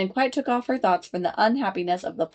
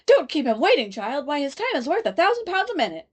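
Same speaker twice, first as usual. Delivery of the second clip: chorus of voices; 7 semitones higher than usual. A narrator's reading. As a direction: scold the child as a crowd. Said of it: "a great many voices all said together".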